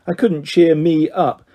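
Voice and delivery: usual speaking voice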